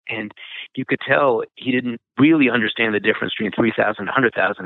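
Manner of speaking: ordinary delivery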